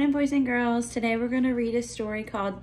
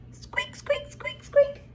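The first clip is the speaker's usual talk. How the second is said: very high tiny squeaks; mouse squeaks; 4 dB quieter, much slower than usual. Pretend. Teacher reading to children, very high mouse squeaks. Mouse sound in picture book.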